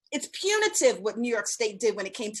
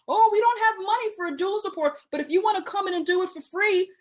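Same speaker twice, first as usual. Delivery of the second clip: mocking hospitals; 6 semitones higher than usual. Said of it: "These for-profit hospitals can no longer call broke".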